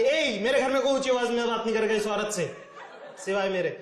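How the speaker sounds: father scolding his son over his wife, hammed-up loud; father shouting. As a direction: lay down the law like a bossy father. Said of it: "वो मेरे पे चिल्लाते".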